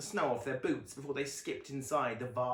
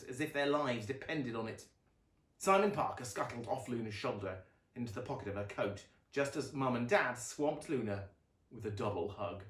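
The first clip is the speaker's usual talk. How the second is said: ordinary delivery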